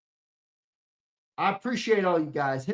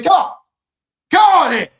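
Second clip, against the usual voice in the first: rising to a loud, high yell; frustrated shout; 10 semitones higher, 11 dB louder than usual. Real, not acted. Streamer fed up with his internet, frustrated shout. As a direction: let it burst out.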